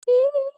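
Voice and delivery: a piercing, loud squeal; high squeal. Real, not acted. Teacher squealing at the class's stale brains. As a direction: squeal in exasperated amusement.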